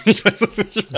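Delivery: hoch und laut lachend gesprochen. Real, not acted. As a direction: prustend vor Lachen. Lacht über eigene Falschantwort. (lachend zugeben)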